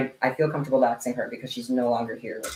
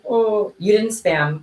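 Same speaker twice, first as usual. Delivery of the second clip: teacher praise; 7 semitones higher, 4 dB louder, slower than usual. Pretend. Mock teacher praising viewer.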